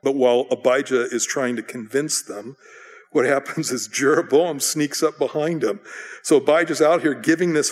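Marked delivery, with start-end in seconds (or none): none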